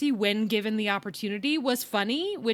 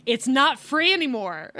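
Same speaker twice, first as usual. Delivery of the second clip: usual speaking voice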